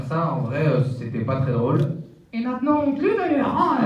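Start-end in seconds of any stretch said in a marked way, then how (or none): 2.3-3.8 goofy muffled sock puppet. Acted. Puppet mocks the ventriloquist's humour.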